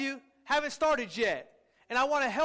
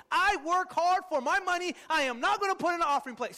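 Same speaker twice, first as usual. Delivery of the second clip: higher, louder mock protest; higher and louder, a hammed-up protest; mock indignation; 5 semitones higher, 3 dB louder than usual. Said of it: "some of you emotionally said".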